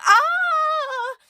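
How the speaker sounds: long high loud shriek; cry of alarm. Acted. Penelope cries out at the hole, high shriek. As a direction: scream at the edge. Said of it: "right after crying".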